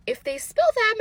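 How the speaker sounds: worried protest. Retold or imitated. Mother worried about car.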